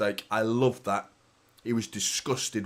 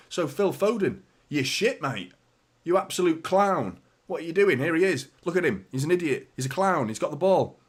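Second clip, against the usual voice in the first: higher-pitched mock outrage at a player; joking mock abuse; 4 semitones higher than usual. Streamer jokingly slagging off his own player, mock exasperation. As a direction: heckle him as a joke, pitched up. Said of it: "I was joking about Phil Foden".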